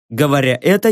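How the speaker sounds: ordinary delivery, nothing marked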